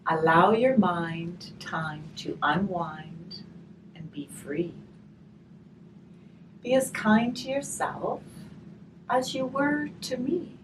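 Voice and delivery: gentle storybook reading, slow on the rhymes; reading to children. Acted. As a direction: read the rhyme aloud to the children. Reading a rhymed picture book aloud.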